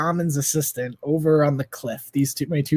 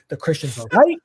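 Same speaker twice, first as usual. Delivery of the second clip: mild alarm, rising at the end; uneasy realization, voice lifting on "right"; acted dread before the outburst; retold dawning panic. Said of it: "I start panicking".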